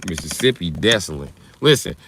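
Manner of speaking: ordinary talk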